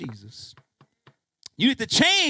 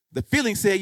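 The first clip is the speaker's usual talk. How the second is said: normal talking voice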